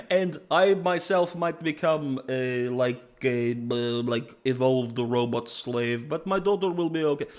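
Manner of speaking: comic Russian villain voice; Russian accent. Acted. Singed offering himself up for his daughter, comic Russian accent. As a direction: put on a mock-solemn Russian accent. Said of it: "he suddenly turned Russian".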